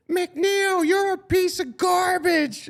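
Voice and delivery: very high, slow mock little-girl heckle; mała dziewczynka. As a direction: screech it drawn out like a little girl. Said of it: "heard this little girl go".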